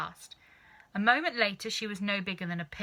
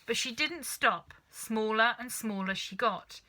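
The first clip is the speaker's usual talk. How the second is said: plain reading voice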